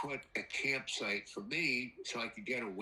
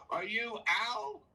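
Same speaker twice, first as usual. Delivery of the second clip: higher, drawn-out imitation of a woman; female voice; 9 semitones higher than usual. Woman across the fire asks the storyteller, imitated female voice. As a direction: imitate her slow, high question. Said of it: "it's a female voice".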